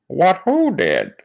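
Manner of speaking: high, drawn-out mock astonishment; Kent Hovind. Kent asking who did it, hammed-up astonishment. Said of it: "this story is Kent Hovind".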